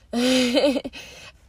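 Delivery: airy relieved chuckle; breathy laugh. Real, not acted. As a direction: laugh under your breath. Laughs after listing past troubles.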